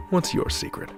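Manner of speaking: ordinary talk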